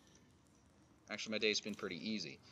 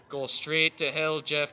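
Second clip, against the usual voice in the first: mock curse; 3 semitones higher, 10 dB louder, slower than usual. Real, not acted. Cursing a game creature.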